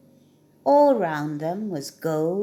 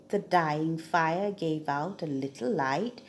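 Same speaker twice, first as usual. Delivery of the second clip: plain reading voice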